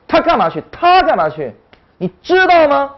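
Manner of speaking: 他干嘛去 and 你知道吗 with high, punched key syllables; emphatic stress. Shown as a demonstration. Teacher shows Chinese has emphasis too, stressed questions. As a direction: hit 他 and 知道 high, then fall. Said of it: "中文里面也有强调".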